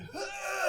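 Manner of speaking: a short, very high gasping wheeze; sharp wheeze. Retold or imitated. Imitating the wheezing visitor at the barn. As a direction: suck in air like you're choking. Mimics wheezing woman's gasp.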